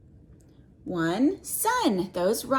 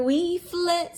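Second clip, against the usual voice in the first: little butterflies, pitched higher; a hint of tiny butterflies, higher; 7 semitones higher than usual. Reading to a child, little butterflies answer higher. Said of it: "said the two".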